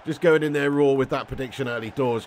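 ordinary delivery

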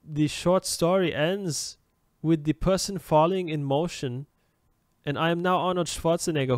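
English in a hammed-up Austrian German accent. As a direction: do a broad German-accented Schwarzenegger take. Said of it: "I'll say it in English with a German accent".

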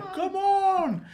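high, loud put-on shout; hammed-up Jedi beckoning; high mock call. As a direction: yell it in a silly squeak. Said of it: "he's like".

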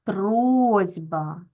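"Просьба" with a long, stressed О; stress on first syllable. Shown as a demonstration. Teacher marks the stressed vowel for pupils, stress on first syllable. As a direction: lean on the О in "Просьба" and stretch it. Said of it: "так, наголос на".

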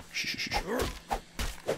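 whispered, slow shushing; playful shush. Pretend. Gamer hushing the viewers, whispered shushing. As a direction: hush everyone, teasing. Hushing while chopping the tree.

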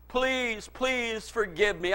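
soft, slow retold begging; imitated dying plea. Dying man asking his pastor's forgiveness, soft imitated plea. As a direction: plead quietly, as if weakened.

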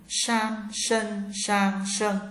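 "San, sân, sang, sân" with tongue curled back; curled-tongue sh on "San, sân, sang, sân", high level tone; retroflex sh. Shown as a demonstration. Teacher drills sh for learners, "San, sân, sang, sân" with curled tongue. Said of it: "S H cong lưỡi".

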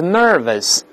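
American nervous, r in the first syllable only; American pronunciation. Shown as a demonstration. Teacher contrasts the two accents, American nervous with r. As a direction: say nervous the American way, ner curled. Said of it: "美国是nervous".

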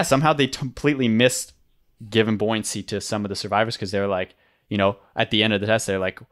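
usual speaking voice

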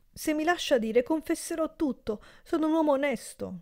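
high, pleading protest; frightened servant insisting he is honest; high anxious plea. A narrator's reading. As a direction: beg to be heard, voice way up.

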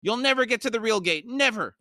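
high-pitched character shriek; hammed-up shrill defiance. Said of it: "Puss shrieked".

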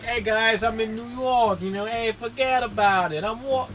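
put-on New York accent. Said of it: "in New York, and, like, he was just like".

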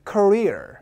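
"career" as kuh-REER, long falling REER; stress on second syllable. Shown as a demonstration. Teacher leading the class together, "career" stressed at the back. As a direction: punch the back half of "career". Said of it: "강세가 뒤에 있습니다".

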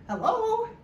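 high, drawn-up exclamation; sudden realization. Retold or imitated. Comedian seeing truth through the donut hole, hammed-up realization. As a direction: pitch leaps up as if it just dawned. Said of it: "I realized".

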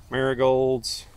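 ordinary talk, nothing marked

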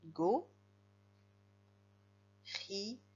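"Go. Ri." dictated with long gaps between syllables. Shown as a demonstration. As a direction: isolate each syllable of "Go. Ri." for spelling. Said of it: "comment s'écrit gorille".

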